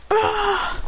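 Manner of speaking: a short high yelp of pain; high pained cry. Retold or imitated. Blasted villain yelps in high pain. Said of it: "He goes".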